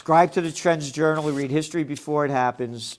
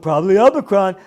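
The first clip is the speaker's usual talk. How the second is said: loud, quicker mock-kid delivery; mocking six-year-old; 3 semitones higher, 9 dB louder, faster than usual. Host ridicules a quoted post as six-year-old talk, mock childish. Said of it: "six years old talking like this".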